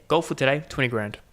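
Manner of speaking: normal talking voice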